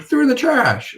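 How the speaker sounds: very high, teasing answer; playful explanation. Retold or imitated. Explaining file thirteen to the receptionist, very high teasing. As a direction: tease them with a singsong explanation. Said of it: "I'm like".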